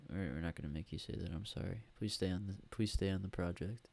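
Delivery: ordinary delivery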